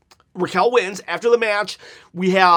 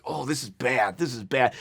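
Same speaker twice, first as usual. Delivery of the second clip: low dismay; 6 semitones lower, 5 dB quieter than usual. Retold or imitated. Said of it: "I'm like".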